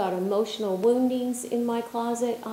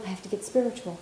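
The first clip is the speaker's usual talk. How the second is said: mock earnest seeker; 6 dB quieter, much faster than usual. Mocking frantic seekers.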